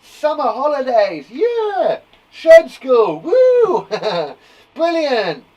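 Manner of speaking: loud, high celebratory cheering; excitement. Shown as a demonstration. Teacher showing pupils excited exclamations, cheering. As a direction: whoop wildly with a laugh. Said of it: "it's exciting".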